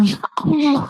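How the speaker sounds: high, dismayed cry to God; shocked exclamation. Retold or imitated. Father dismayed at son eating before old woman, high cry. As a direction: gasp it out in dismay.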